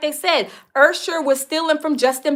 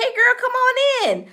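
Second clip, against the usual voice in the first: mock welcoming host; 9 semitones higher than usual. Voicing herself opening the door.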